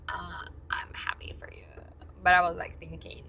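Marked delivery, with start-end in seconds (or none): 0.1-1.7 forced whisper of fake joy; whispered reply. Answering the boyfriend's demand, whispered. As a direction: mutter it under your breath, unconvinced. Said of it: "And I'm like".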